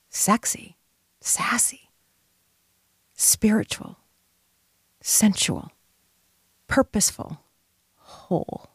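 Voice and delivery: near-whispered, one word at a time; soft, breath-led incantation; hushed. Real, not acted. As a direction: let each word fall softly into a pause. Intimate list of feminine qualities.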